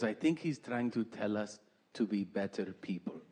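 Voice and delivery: put-on pious mother in a light Peruvian accent. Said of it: "she's from South America, Peru".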